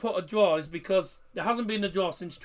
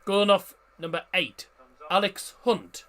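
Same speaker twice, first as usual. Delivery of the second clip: loud stadium-announcer style, names punched out; substitution announcement; 7 dB louder than usual. Acted. Host tells viewers of York City's double substitution, announcer style. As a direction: announce it to the crowd, name by name.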